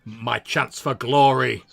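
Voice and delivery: eager would-be hero. Acted. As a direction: savour the moment, building to glory. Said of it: "she's like".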